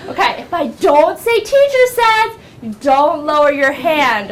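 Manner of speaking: put-on high, emphatic teacher explaining to kids; instructing classroom teacher. Shown as a demonstration. Teacher explaining the game rules, high and emphatic.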